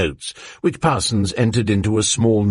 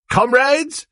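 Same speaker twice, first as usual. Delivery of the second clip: high, loud eager telescreen voice; rally the comrades with good news; bright propaganda announcer calling out; eager youthful announcer; 3 dB louder than usual. A narrator's reading. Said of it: "came an eager, youthful voice".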